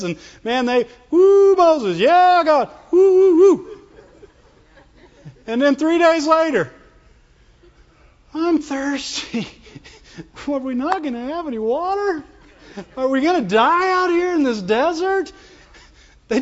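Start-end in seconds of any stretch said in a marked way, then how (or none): 1.1-3.8 loud, high-pitched party whoops; hammed-up jubilant cheer; mock cheering. Imitates a crowd partying, cheering God. 8.3-15.3 high, pitiful mock whine; hammed-up plaintive whimpering, pitched up; whining. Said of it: "they whined".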